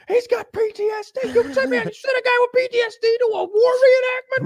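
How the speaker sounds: high, loud exasperated disbelief; shrieking at the absurd decision; incredulous outburst. Retold or imitated. Larry ranting about sending a PTSD guy to reenactment, high indignant outburst. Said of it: "Larry's like".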